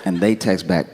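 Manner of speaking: normal talking voice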